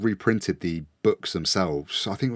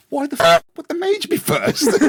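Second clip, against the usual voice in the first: much higher and louder, laughing disbelief; exasperated outburst; 6 dB louder than usual. Real, not acted. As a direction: cry out in amused frustration. Exasperated rant about game logic.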